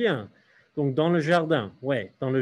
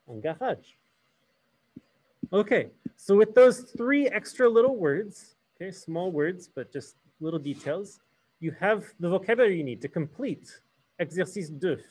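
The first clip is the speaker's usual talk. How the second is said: normal talking voice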